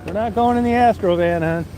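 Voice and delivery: high sing-song voice for the dog; pet talk. Real, not acted. To his dog Daisy about the van, high cooing pet talk.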